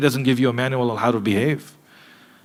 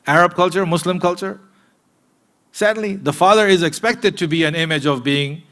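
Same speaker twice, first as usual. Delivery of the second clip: ordinary talk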